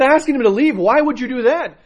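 high, loud disbelieving cry of the monks; shocked brothers who can't believe their ears; astonished monks. Retold or imitated. Monks astonished at dying abba.

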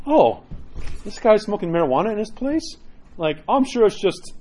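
high, wide-eyed innocent wonder; mock-naive surprise. Acts naive visitor noticing marijuana.

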